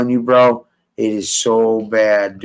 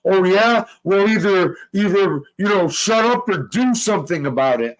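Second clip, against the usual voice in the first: mocking impression; 6 semitones higher than usual. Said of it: "the times where he's like".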